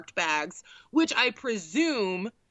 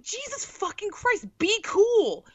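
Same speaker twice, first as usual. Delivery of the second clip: much higher, exasperated "be cool"; exasperated hiss-free plea; 11 semitones higher, slower than usual. Retold or imitated. Daughter telling her scared mom to be cool, exasperated and high. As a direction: beg her to calm down, incredulous. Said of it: "I'm like".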